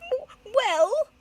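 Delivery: high, cracking stammer; flustered stammer. Acted. Headmistress faltering before the director. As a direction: caught out and squirming.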